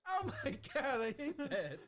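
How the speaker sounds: high, loud laughing revulsion; disgusted outburst. Real, not acted. Taster reacts to awful cereal, loudly disgusted. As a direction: cry out in appalled disbelief.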